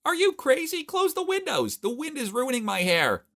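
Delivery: high, loud shriek at the driver; shrill shout. Acted. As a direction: scream it way up high, furious about her hair. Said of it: "as she shouted".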